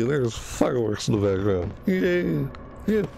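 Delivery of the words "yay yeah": high little celebratory cheers; gleeful cheer. Real, not acted. Cheering the fireworks.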